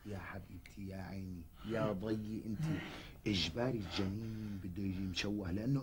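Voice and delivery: calm her down gently; soothing whisper. Acted. Husband soothes wife.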